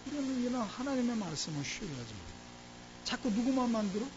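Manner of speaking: ordinary talk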